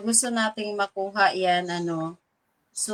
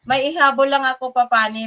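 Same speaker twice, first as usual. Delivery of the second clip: normal talking voice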